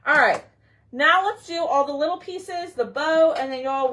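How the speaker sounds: ordinary talk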